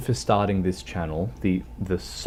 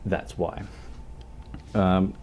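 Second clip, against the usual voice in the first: normal talking voice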